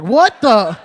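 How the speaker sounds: high, loud, laughing what the; stunned disbelief. Retold or imitated. Comic left stunned as the man walks off, loud shocked laugh. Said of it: "I was like".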